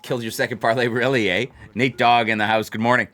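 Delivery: ordinary talk, nothing marked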